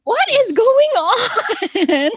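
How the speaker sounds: high, slow, laughing disbelief. Retold or imitated. Wife at the pump reacts to a signup with disbelief. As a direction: stretch it out in happy shock.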